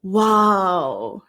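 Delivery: amazed "Wow" as if at fireworks; a student marvelling at a firework display. Shown as a demonstration. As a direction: let the wonder out on "Wow". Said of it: "what is the word that comes out".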